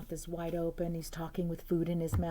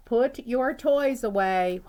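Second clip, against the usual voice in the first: high, loud, slow motherly order; stern mom command; 6 semitones higher, 8 dB louder, slower than usual. Acted. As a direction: order him slowly like a cross mom. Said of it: "David's mom always said".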